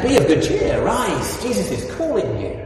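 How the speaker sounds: called out high and loud, all eager; eager crowd calling. Retold or imitated. Crowd urging the blind beggar to rise, called out eagerly. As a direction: cheer him up the road like a crowd that changed sides. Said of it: "the crowd is suddenly on his side".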